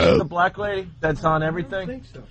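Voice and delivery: normal talking voice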